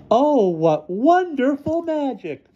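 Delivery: very high, slow squeal; shrill cry. A narrator's reading. Tamara admires the magic trick, crying shrilly. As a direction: squeal it shrilly like a little girl. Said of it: "cried Tamara shrilly".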